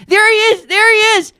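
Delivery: high, loud mock old-lady cries; urgent calling out. Old lady spotting her man from the shuttle, urgent high cries. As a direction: call out to halt the driver.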